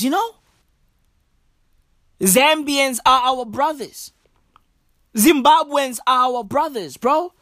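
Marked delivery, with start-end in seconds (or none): none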